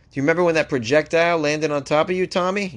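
mock Australian accent, teasing a mate; Aussie firefighter teasing his mate, mock Australian accent. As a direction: rib Tommy in a put-on Australian accent. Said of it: "Is that a pretty good Australian accent?".

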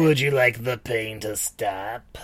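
slow, creepy demon offer. Retold or imitated. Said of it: "the creepy-ass voice".

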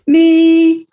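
spring the answer like a reveal; a joyful little gotcha; triumphant punchline. Real, not acted. Punchline answer to own question.

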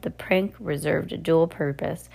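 ordinary delivery, nothing marked

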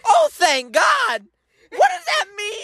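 high-pitched shout of disbelief; hammed-up, wailing incredulity; incredulous outburst. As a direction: throw the words back in exasperation. Incredulous repeat of waitress's reply.

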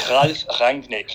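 "Ralf Rangnick" slow and loud, R's rolled; rolled German R's. Shown as a demonstration. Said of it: "practice rolling your R's".

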